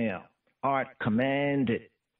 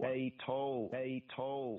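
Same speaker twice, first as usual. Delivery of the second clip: soft echo; 11 dB quieter, slower than usual. Shown as a demonstration. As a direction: repeat the word very softly, much softer, like a quiet echo. Soft echo of the Hebrew word.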